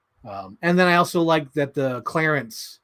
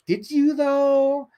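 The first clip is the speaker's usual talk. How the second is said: much higher, very slow and sly; high, slow mock-camp put-down; campy fashion designer; 11 semitones higher, 3 dB louder, much slower than usual. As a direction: stretch it out high like a sassy designer. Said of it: "the fashion designer's like".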